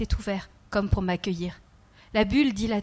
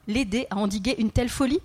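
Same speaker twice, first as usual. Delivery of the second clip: ordinary delivery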